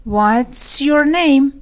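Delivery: drawn-out teaching repeat; slow and stretched. Shown as a demonstration. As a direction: stretch every word of "What's your name". Lesson repeats it slowly. (خیلی آهسته و کشیده)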